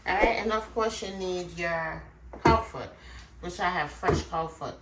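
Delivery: normal talking voice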